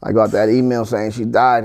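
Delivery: normal talking voice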